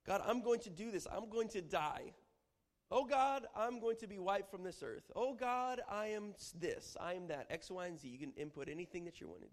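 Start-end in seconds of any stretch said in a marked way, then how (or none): 0.1-8.1 high, loud, anguished pleas; desperate pleading. Acted. Preacher acting a despairing prayer to God. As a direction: pour out your despair to God. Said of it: "a declaration to pour out".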